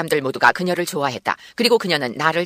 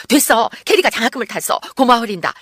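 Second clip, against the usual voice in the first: high, louder burst of joy; overjoyed; 6 semitones higher, 5 dB louder than usual. A narrator's reading. Judy thanking her friend for the scholarship letter, overjoyed. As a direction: announce good news, thrilled and grateful. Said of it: "주디가 뛸 듯이 기뻐하며".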